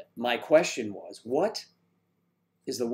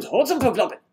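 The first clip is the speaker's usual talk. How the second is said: rattled off fast, louder and higher; quick, punchy, high-pitched rush; 10 semitones higher, 5 dB louder, faster than usual. Shown as a demonstration. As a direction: run the words together at speed. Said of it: "If you say it very fast".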